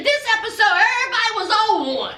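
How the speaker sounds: ordinary talk, nothing marked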